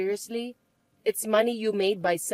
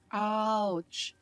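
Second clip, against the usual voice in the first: normal talking voice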